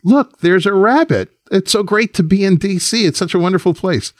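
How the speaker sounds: high-pitched, wide-eyed gushing; sing-song high voice of a naive, delighted colleague; gushing staffer. Acted. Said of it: "looks and says".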